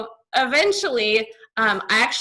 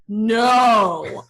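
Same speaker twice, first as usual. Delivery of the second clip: emphatic no; 3 semitones lower, 3 dB louder than usual. Retold or imitated. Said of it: "I was like".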